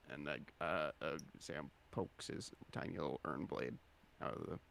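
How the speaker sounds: ordinary delivery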